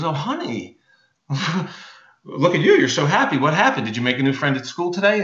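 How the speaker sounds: lightly acted doting mom, quick at the end; delighted mom. Mom greeting her happy little girl, delighted and gushing. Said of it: "her mom sees her and goes".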